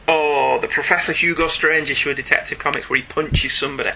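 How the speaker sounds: ordinary talk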